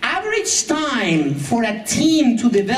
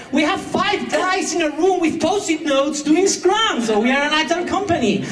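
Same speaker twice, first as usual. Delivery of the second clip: mock pride; 6 semitones higher than usual. Mocking the company's claim.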